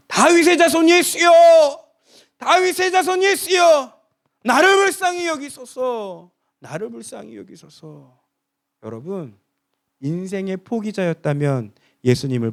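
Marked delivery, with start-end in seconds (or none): 0.1-6.3 high, loud, slow crying out; desperate cry. Acted. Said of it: "바디메오는 소리를 지릅니다". 6.6-8.2 fading plea. Acted. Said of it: "소리를 지릅니다".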